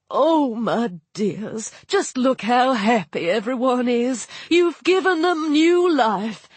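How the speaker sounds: lightly voiced kindly hippo nurse; a hint of a fond hippo goddess. A narrator's reading. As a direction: gush like a doting old nurse. Tawaret gushing.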